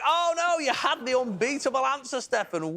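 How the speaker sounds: high-pitched cry of dismay; dismayed reveal. Real, not acted. Host telling contestant he had the winning answer, high-pitched dismay. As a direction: break the bad news with a high, sinking "oh no".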